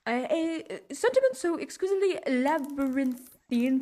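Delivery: high, grandly florid mock eloquence; pompous orator. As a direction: orate like a pompous scholar. Mock-eloquent speech over hummed classical music.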